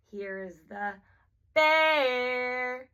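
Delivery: "bear" stretched long, swooping up and then down; rise-fall on BEAR. Shown as a demonstration. Therapist changing intonation to highlight a word, "bear" long, up then down. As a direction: sing out "bear" high, then let it fall. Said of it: "goes up and then down".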